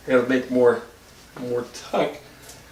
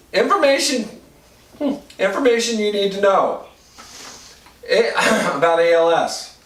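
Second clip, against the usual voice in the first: shivering; 5 semitones higher than usual. Real, not acted. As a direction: voice shaking from the cold. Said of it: "I'm a little cold at the moment".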